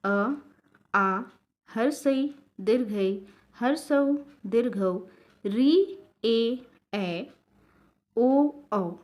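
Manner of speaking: each Hindi vowel said slowly and distinctly, short ones clipped and long ones held; स्वरों का साफ़ और धीमा उच्चारण; careful vowel demo. Shown as a demonstration. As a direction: spell out every vowel clearly for the learners. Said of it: "इसके उच्चारण पर ध्यान दीजिएगा".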